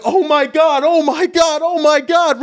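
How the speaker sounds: high, loud, repeated cry of alarm; mock panic. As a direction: play up helpless freaking out. Retold helpless panic in the rain.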